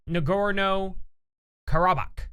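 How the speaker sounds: "Nagorno-Karabakh" said slowly and carefully, syllable by syllable; unsure pronunciation. Shown as a demonstration. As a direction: spell out "Nagorno-Karabakh" slowly, testing each sound. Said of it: "I think is how you pronounce it".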